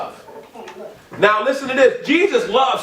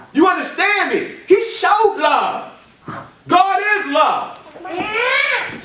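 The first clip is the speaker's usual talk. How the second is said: fiery preaching; 5 semitones higher, slower than usual. Acted. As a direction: preach it out to the whole church. Preaching at full force.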